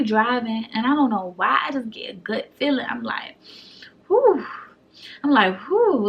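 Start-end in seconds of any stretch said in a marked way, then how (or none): 4.1-4.4 loud high nervous exhale; nervous whew. Retold or imitated. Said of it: "I'm breathing hard". 5.7-6.1 loud high nervous exhale; nervous whew. Retold or imitated. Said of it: "I'm breathing hard".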